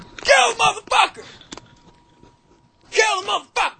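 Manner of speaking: high, loud librarian yell. Acted. Librarian lady at the fights, yelling at a fighter. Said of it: "this librarian lady's just yelling".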